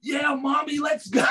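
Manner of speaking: put-on high, shouting little boy begging; excited kid. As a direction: yell it like an eight-year-old fan. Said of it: "being an eight-year-old kid".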